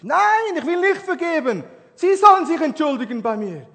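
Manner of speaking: high, loud angry no; defiant refusal. Retold or imitated. Young man refusing the pastor's advice, angry shout. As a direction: snap back a furious no.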